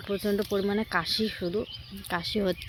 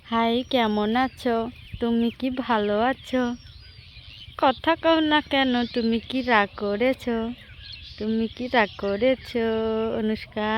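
mother gently coaxing her silent daughter, slow and lilting; 3 semitones higher, slower than usual. Real, not acted. Mother baby-talks to daughter.

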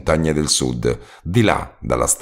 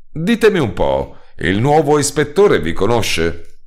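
higher, slower sly question; asking; 6 semitones higher than usual. A narrator's reading. Narrator asking the roadman if the inspector knows him. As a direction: ask with a sudden idea.

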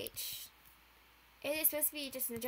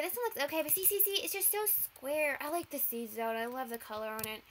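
ordinary talk, nothing marked

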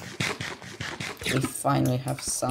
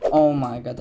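excited exclamation; 8 dB louder than usual. Real, not acted. Finds treasure.

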